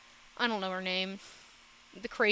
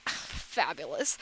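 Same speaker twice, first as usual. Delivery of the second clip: lower, sighed-out mock admiration; mock swooning; 5 semitones lower than usual. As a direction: sigh it out as if smitten. Mock reaction to fabulous character.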